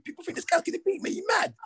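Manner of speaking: high, incredulous taunting voice; squeaky mock-indignant "You mad?"; mock outrage. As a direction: jeer it like you can't believe anyone doubts you. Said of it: "I'm like".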